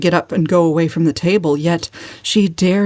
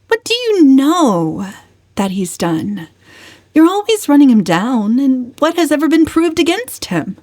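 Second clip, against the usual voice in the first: challenging; 8 semitones higher than usual. A narrator's reading. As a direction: call them out on running him down. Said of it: "Valancy challenging her uncle".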